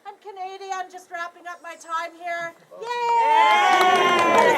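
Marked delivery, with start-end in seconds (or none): 2.8-4.6 loud happy whoop; excited cheer. Real, not acted. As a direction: cheer it out for the crowd. Cheering about going home.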